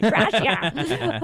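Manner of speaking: laughing Wisconsin accent on Gracia. Shown as a demonstration. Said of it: "My Wisconsin accent".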